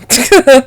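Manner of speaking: short loud laugh, high; exasperated laugh; angry laugh. Real, not acted. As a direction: burst out laughing mid-rant. Laughing while venting anger.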